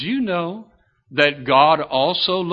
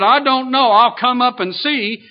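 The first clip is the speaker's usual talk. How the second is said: higher, lightly hammed-up old brother shouting back; mock old man yell; 7 semitones higher, 3 dB louder than usual. Younger brother answering from downstairs, a higher mock yell. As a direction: answer up the stairs, clueless but willing. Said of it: "yelled back and said".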